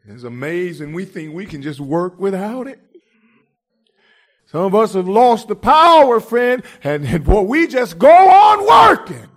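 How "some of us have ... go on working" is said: preacher at full cry, pushing each phrase; preaching shout. Real, not acted. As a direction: shout it out, higher and louder, full force. Preacher rising to a climax.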